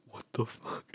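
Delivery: startled whisper. Retold or imitated. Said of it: "he's like".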